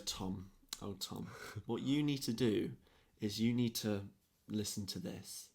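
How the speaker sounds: imitating a teacher's hushed advice, with pauses; quietly counsel, pausing between phrases; soft retold counsel.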